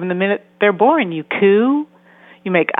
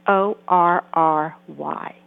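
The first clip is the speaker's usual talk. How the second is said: slow, letter by letter, S O R R Y; spelled out slowly; 2 semitones lower, much slower than usual. Shown as a demonstration. Therapist spelling out a teen's text for parents, slow and letter by letter. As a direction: spell out S O R R Y one letter at a time. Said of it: "the word that they're asking me to decipher".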